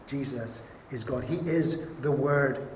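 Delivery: ordinary talk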